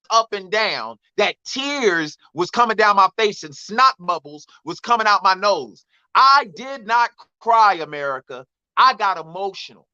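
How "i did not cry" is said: "I did not cry" slow and drawn out; weight on every word of "I did not cry"; emphatic denial. Real, not acted. Man protesting to America, emphatic denial.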